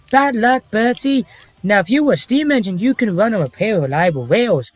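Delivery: lilting engine dialogue in a narrator impression; Michael Angelis. Shown as a demonstration. Continuing the requested episode recital, narrator impression. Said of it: "Let's hear your Michael Angelis impression".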